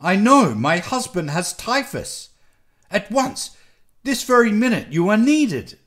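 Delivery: high, loud, desperate demand; urgent insistence. A narrator's reading. Nelly urgently pleads with reluctant doctor.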